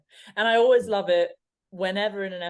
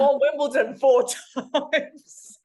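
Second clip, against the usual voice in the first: high, loud punchline breaking into laughter; laughing disbelief; 8 semitones higher, 8 dB louder, slower than usual. Real, not acted. Player telling how she didn't recognise a champion, laughing in disbelief. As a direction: crow it slowly, cracking up on the last words. Laughing at her own blunder.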